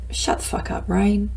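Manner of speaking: unvoiced hushed shut up to herself; whispered telling-off. Speaker silencing her own inner critic in a whisper. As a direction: hiss it quietly at your own brain. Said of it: "I would go".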